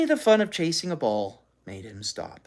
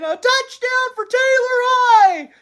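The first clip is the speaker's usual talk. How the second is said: put-on thrilled boy; 8 dB louder than usual. A narrator's reading. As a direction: cheer like a kid at a touchdown. Boys celebrating touchdown.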